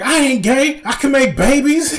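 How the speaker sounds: high-pitched imitated indignation; ranting old man. Old man defending himself to crowd, mock indignant. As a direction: protest like a worked-up old man, close to laughing.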